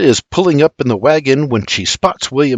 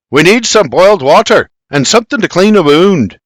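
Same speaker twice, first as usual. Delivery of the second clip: loud, raised desperate demand; bellowing; 6 semitones higher, 6 dB louder than usual. A narrator's reading. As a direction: bellow while searching frantically. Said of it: "he billows".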